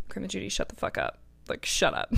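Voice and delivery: hushed, mortified aside; half-whispered shut up to grandma; embarrassed hush. Retold or imitated. Embarrassed grandma praised her to the guy, hushed and mortified.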